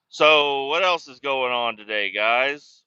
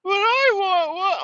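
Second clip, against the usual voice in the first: put-on much higher, louder, slow drawn-out kid whine; whiny child; 5 dB louder than usual. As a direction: stretch it like a sulking little girl. Daughter whining for her ornament.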